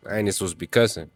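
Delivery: normal talking voice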